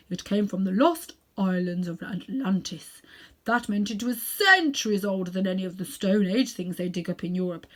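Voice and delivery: put-on self-important elderly uncle showing off; Uncle Andrew. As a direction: lecture the boy like a vain old magician. Narrator voices Uncle Andrew.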